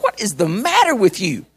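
exasperated retold scolding, each word stressed; disgusted. Rancher to the helper who fled the cow, disgusted. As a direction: demand it in mock disgust. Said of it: "I'm so disgusted with this guy".

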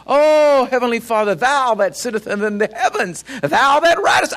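grandiose, old-fashioned praying put on; lofty mock prayer. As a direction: intone it like a showy formal prayer.

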